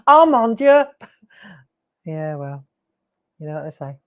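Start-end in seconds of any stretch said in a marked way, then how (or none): 0.1-0.8 mock French exclamation.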